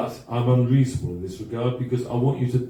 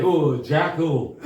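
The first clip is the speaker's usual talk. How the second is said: slow, drawn-out Yorkshire "Oh, Jack Hall"; "Jack Hall" stretched out Yorkshire style, with a laugh; Yorkshire accent; much slower than usual. Shown as a demonstration. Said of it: "if you say it with a Yorkshire accent".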